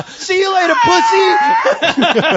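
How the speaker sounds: very high, squealed farewell jeer; mock taunt. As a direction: crow it in falsetto, close to laughter. Joking getaway taunt.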